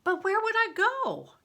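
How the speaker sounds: high, loud worried question; anxious. A narrator's reading. Crispin asking Bear where to flee, anxious. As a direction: plead in alarm.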